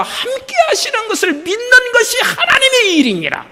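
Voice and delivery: high, laughing, falling on 하나님의; joyful restatement. Acted. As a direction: let 하나님의 spill out with a laugh, then fall.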